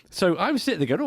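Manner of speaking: ordinary delivery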